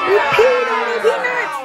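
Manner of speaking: high, loud silly shout; elephants shout. Acted. Elephants shouting their strike grievance, high and loud. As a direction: bellow it like indignant elephants. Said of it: "elephants shout".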